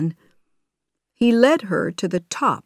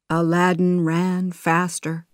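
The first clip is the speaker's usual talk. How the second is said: ordinary delivery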